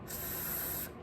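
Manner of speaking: a long, voiceless F on its own; F sound alone. Shown as a demonstration. Teacher shows the class the starting sound, a lone sustained F. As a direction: hold just the F sound, top teeth on lip. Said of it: "F sound".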